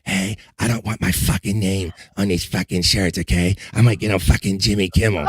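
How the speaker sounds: hushed imitation of a whispery podcaster; mock whisper. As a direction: sneer it like a late-night whisper show. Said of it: "where he's whispering".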